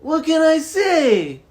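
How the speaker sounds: high, loud hammed-up Italian accent; a clumsy put-on Italian voice; mock Italian accent. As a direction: play the proud Italian, badly. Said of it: "I'm not good at accents".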